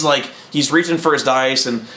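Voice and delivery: plain reading voice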